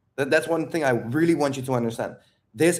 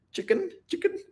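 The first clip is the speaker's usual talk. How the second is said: squeaky chicken call; 5 dB quieter than usual. Acted. Mocking butterfly flap as chicken.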